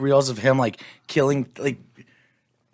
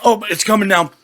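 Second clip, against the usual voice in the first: eating sour candy; 7 semitones higher, 3 dB louder than usual. Real, not acted. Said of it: "My tongue is numb".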